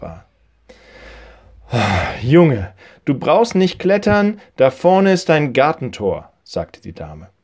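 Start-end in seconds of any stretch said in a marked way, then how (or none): 2.2-6.3 loud, higher woman's voice; lady calling after boy. Acted. Old lady points boy to the gate, calling out high and loud. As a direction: call out to stop him climbing.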